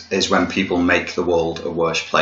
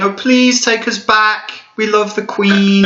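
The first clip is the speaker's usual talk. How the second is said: higher, slower mock-pleading plea; mock pleading Americans; 14 semitones higher, 3 dB louder, slower than usual. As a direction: whine it like a begging crowd. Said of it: "everybody's in the streets in America saying".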